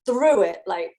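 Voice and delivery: ordinary talk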